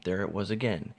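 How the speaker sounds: usual speaking voice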